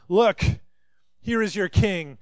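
a sneering governor showing off a beaten man; mocking Pilate. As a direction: jeer at the crowd with Look, here he is. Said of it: "Mocking not only Jesus".

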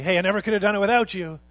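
loud, fast, upbeat shout of thanks; a loud cheery thank-you before a crowd; public shout-out. Retold or imitated. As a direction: call it out proudly to the room. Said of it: "stand up and say".

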